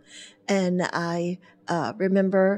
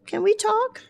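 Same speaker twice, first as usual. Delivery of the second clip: pointed question; 14 semitones higher than usual. Retold or imitated. Her thought on today's politics.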